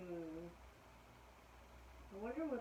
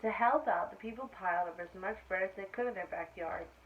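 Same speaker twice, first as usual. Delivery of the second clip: lively, louder read-aloud voice; story reading to children; 8 dB louder than usual. A narrator's reading. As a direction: read it brightly to a class of kids. Reading picture book aloud.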